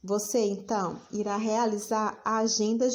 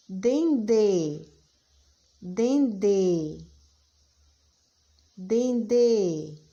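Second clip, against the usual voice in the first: Dendê spoken louder, each syllable clearly marked; dictation; 4 dB louder, much slower than usual. Shown as a demonstration. Teacher dictating to children, louder and clear. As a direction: call out Dendê clearly for the class to write. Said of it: "Próxima palavra".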